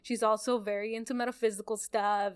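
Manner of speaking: normal talking voice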